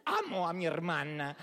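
amor fingido, con el enojo contenido; enojo reprimido. Acted. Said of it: "por más que yo reprima el enojo". (cariño forzado que tapa el enojo)

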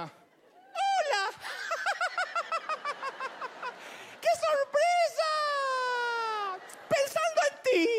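saludo agudísimo con carcajada fingida; alegría falsa de mamá. Retold or imitated. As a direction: recibe la visita exagerando una alegría falsa. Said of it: "Ahí salía mi mamá". (imitación fuerte y chillona de la mamá hipócrita)

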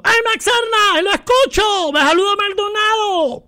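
very high, drawn-out fan's holler; high-pitched mock shouting, stretched vowels; imitated yell from above. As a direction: imitate someone hollering down from the stands. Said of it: "así me gritó un brother desde arriba".

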